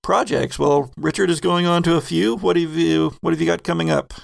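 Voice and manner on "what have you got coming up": ordinary talk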